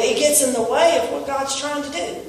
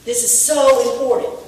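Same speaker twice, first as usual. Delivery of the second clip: ordinary delivery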